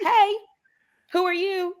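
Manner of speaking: high, bright-voiced greeting imitation; mock high party greeting; imitating Tasha. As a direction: lift the voice way up as Tasha.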